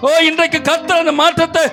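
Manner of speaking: high, driving preacher's cry; impassioned preaching. Real, not acted. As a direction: build from urging to a shouted promise. Preaches at full force.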